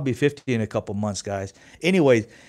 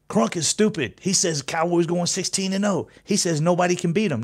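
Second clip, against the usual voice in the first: mock chat hater; 6 semitones higher than usual. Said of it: "I'll probably hear in my chat box".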